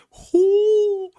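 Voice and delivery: high, loud whooping cheer; a loud "hoo" as the crowd goes wild; crowd roar. Acted. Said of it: "The crowd is like".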